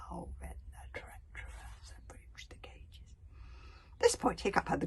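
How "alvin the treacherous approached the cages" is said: slow ominous whisper; hushed. A narrator's reading. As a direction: draw it out in a menacing whisper. Villain creeps up.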